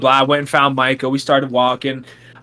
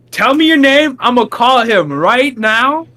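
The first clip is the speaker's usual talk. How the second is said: higher, louder, slowing on the threat; heated demand; 7 semitones higher, 4 dB louder than usual. Retold or imitated. Threatening to call Micah on the mailman, loud. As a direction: push back hard. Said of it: "And I was like".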